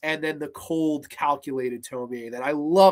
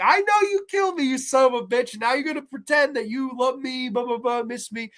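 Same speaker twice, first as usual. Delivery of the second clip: high-pitched indignant mock rant; mock accusation; 7 semitones higher than usual. Tomie to the man who killed her, mock indignation. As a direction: confront in a high voice, easing off as it goes.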